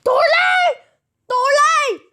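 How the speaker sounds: high, loud shriek; mother yelling at her son; yelled. Acted. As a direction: scream it up high. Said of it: "yelled the mother".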